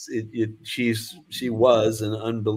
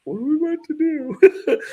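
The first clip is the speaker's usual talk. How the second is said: laughing disbelief; 5 dB louder than usual. Retold or imitated. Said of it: "And I'm like".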